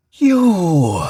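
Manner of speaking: loud, high ghost screech; ghostly screech. Acted. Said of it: "the thing screeched again".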